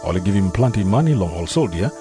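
ordinary talk, nothing marked

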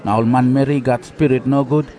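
ordinary delivery

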